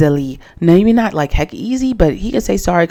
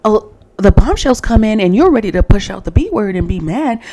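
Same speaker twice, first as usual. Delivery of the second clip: ordinary delivery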